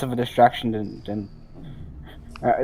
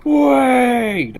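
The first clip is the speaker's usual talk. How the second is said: high, drawn-out pub jeer; long loud heckle, falling off at the end; bar crowd cheer; 13 semitones higher, 6 dB louder than usual. Acted. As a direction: call out like a whole bar at dropped drinks. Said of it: "everyone in the bar just go".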